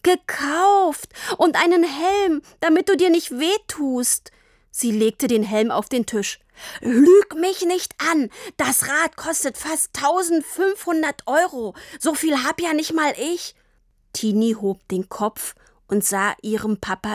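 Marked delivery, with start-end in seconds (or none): 0.0-4.3 put-on high, hurt child's protest; close to tears. A narrator's reading. Tini defends her present to Papa, close to tears. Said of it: "schossen sofort die Tränen in die Augen". 6.8-13.6 high, forceful outburst; angry accusation. A narrator's reading. Papa accuses Tini of lying about money, angry accusation.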